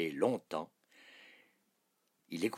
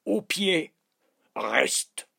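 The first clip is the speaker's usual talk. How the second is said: king loudly ordering his dog about; shouted commands; 7 semitones higher, 8 dB louder, slower than usual. A narrator's reading. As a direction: call out each order forcefully. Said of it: "cria le roi".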